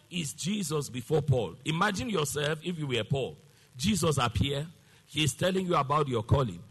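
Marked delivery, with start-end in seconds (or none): none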